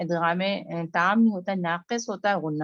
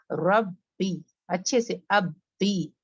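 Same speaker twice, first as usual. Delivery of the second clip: normal talking voice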